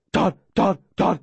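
yappy barked name; dog barking a name. Acted. Dog barking to lure the man away, yappy. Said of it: "My dog barking my name".

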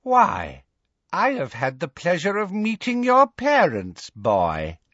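evil smile in the voice; Bellatrix. A narrator's reading. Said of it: "a truly evil smile lit".

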